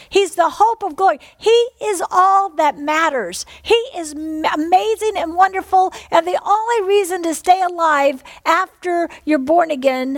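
rally the congregation, full fervour; rising pulpit exhortation; preaching at full force. Acted.

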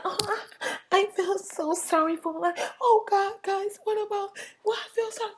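high, soft whimpering voice; mock sympathizers. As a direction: imitate pitying fans in a tiny high voice. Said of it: "some of y'all was like".

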